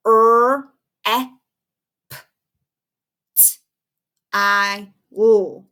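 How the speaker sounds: "Er, e, p, t, i, l" as separate letter sounds with pauses; segmented phonics, each of "Er, e, p, t, i, l" on its own; tapped-out sounds. Shown as a demonstration. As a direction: sound out reptile one phoneme at a time, "Er, e, p, t, i, l". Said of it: "tap it out".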